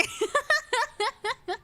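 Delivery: high-pitched giggling fit; real high laugh. Real, not acted. Streamer amused by fans teasing Robert, real high laugh. Laughs at her own retelling.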